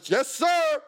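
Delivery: high, snappy retold reply; mock-panicked answer to police; startled obedience. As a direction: act out scared compliance. Frozen, obeying armed police.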